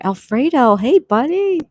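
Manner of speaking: high, loud delighted hello; excited greeting. Real, not acted. Streamer greets Alfredo joining the chat, high excited greeting. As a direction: greet an arriving friend with rising pitch and volume.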